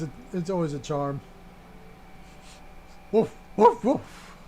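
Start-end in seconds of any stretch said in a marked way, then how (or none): 3.1-4.4 high, loud playful woofs fading to a breathy woof; silly dog impression; mock dog barks. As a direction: bark like a small dog for fun. Imitates the dog companion.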